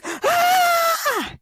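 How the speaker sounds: high, startled scream. Retold or imitated. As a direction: shriek in sudden terror.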